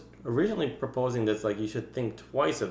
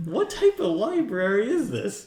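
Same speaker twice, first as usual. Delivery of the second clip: baffled; 10 semitones higher, 3 dB louder than usual. Retold or imitated. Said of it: "I'm like".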